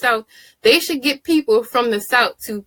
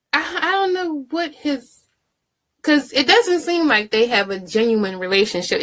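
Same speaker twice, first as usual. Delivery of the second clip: ordinary delivery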